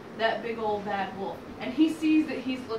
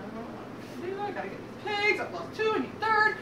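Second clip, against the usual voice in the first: fretting wolf; 8 semitones higher, faster than usual. Acted. Wolf frets while pacing.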